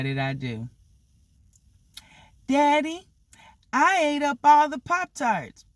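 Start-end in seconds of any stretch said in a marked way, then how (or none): 2.5-5.6 put-on high-pitched little kid voice; child confessing. Kid falsely confesses to Daddy, in a high child voice.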